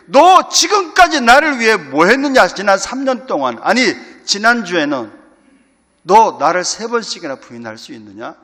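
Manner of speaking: harsh rebuke. Pretend. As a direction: bark the accusation as an angry master. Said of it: "막 호되게 책망할 만도 한데". (호되게 꾸짖는 큰 목소리)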